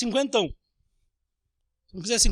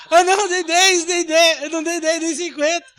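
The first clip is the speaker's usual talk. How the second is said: acted customer insisting, raised and louder; mock argument; 7 semitones higher, 6 dB louder than usual. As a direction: insist on the change, half laughing. Customer arguing over change.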